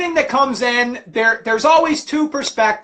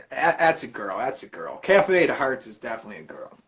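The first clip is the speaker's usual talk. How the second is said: much lower, quieter, fast at the end; deep mock voice; 11 semitones lower, 7 dB quieter, faster than usual. Real, not acted. Streamer teasing the viewer about being a girl, low. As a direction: drop into a low mocking rumble.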